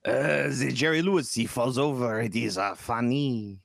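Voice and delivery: mock French accent, stretched-out last word. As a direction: put on a hammy French accent. Mocks French taste in comedy.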